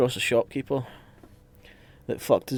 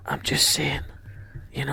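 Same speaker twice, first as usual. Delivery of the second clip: unvoiced whisper; 2 semitones higher, 3 dB quieter than usual. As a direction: whisper it casually. Player keeps whispering.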